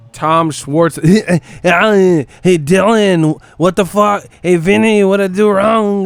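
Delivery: high, loud caricature voice; loud, high-pitched mock shouting; mock Frank Warren. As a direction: ham up a promoter shouting at his fighters. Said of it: "Frank Warren said".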